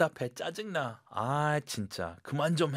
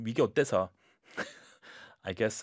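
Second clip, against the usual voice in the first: ordinary talk, nothing marked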